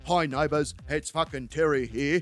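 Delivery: lightly voiced blokey friend, matter-of-fact; a hint of a dull-witted mate; Terry.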